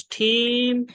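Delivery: team drawn out high, puff after the t; aspirated T. Shown as a demonstration. Teacher modelling next example word, aspirated t in team. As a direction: say team slowly with a strongly released t. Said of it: "this letter as a ta".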